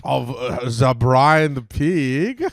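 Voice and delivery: slow, drawn-out camp self-introduction. Acted.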